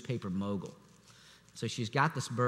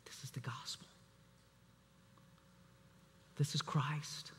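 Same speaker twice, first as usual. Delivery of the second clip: whispered in awe, almost to himself; awed whisper; 4 semitones higher, 11 dB quieter than usual. Real, not acted. Preacher recalling watching the show, whispering in awe. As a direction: hush it like a revelation.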